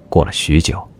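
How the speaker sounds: usual speaking voice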